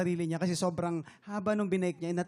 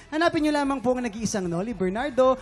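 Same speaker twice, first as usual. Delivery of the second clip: usual speaking voice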